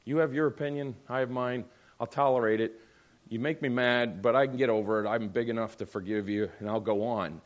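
low, resigned brush-off; passive dismissiveness. Acted. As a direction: imitate someone passively shrugging off the argument. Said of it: "Now I'm more afraid of passive".

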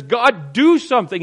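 loud, high, indignant cry to God; righteous indignation. Acted. Habakkuk pleading with God over injustice, righteously indignant. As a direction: demand action at the top of your voice. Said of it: "It's righteous indignation".

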